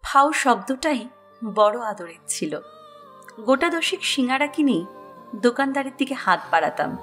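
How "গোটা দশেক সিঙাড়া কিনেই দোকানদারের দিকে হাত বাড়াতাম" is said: usual speaking voice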